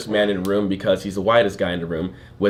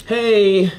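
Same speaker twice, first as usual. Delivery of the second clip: higher, drawn-out mock video plea; rinky-dink video poster; 8 semitones higher, much slower than usual. Host mimicking people posting videos online, mock amateur plea. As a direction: call out slowly, mocking an amateur pitch. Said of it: "the little rinky-dink".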